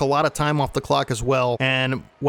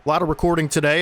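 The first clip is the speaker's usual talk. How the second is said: normal talking voice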